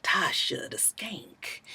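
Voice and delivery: slow, soft whisper of "Tasha the Skank"; menacing whisper. Retold or imitated. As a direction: breathe "Tasha the Skank" slowly, like a threat.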